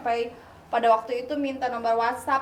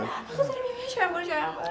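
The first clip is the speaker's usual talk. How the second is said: put-on drunk; 11 semitones higher, 8 dB quieter than usual. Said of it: "ya udah pura-pura".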